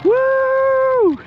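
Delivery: a loud, high whoop of delight; excited whoop. Real, not acted. Pilot cheering his plane's pass, a loud high whoop. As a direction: cheer the jet as it screams past.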